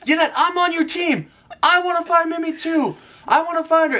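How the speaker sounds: high mock pleading voice. Husband retelling pleading with his wife, high mock pleading. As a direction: plead that you're on her side. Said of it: "I'm like".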